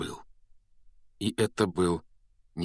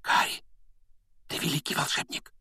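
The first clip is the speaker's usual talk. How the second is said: soft voiceless whisper; 4 dB quieter than usual. A narrator's reading. Hermione hugging Harry, whispering in his ear. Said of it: "прошептала Гермиона ему на ухо".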